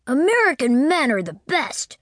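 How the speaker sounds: put-on high, boastful child voice; boy proudly declaring; little boy declaring. A narrator's reading. Boy declaring to Winterbourne, high and boastful.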